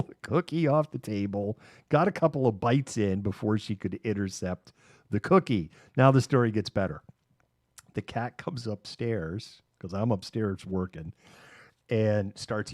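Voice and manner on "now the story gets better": ordinary talk, nothing marked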